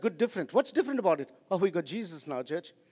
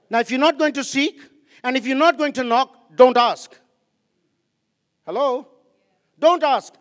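louder, rising challenge ending in a loud "don't ask"; preaching at full force; 4 semitones higher, 8 dB louder than usual. Acted. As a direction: call the congregation out. Preaching to the congregation.

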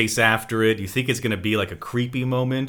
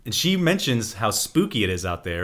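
ordinary talk

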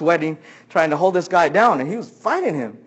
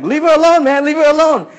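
high, loud repeated yell; 9 semitones higher, 6 dB louder than usual. Retold or imitated. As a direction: shout him away from her. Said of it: "yelling at him".

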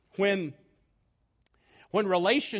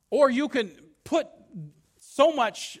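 1 emphatic raised voice; 5 semitones higher, 6 dB louder than usual. Real, not acted. Preacher emphasis.

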